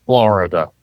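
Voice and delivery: put-on low, drawn-out old Florida drawl; old rural Florida drawl. Shown as a demonstration. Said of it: "that Floridian accent you got".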